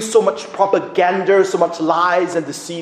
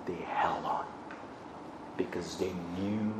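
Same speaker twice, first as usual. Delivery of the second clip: soft, breathy whisper; whispered reassurance; 10 semitones lower, 16 dB quieter than usual. Acted. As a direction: hush it like a secret. Preacher drops to hushed tone.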